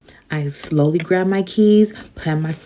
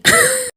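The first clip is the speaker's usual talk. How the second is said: laugh; 4 dB louder than usual. Real, not acted. Laugh at her own request.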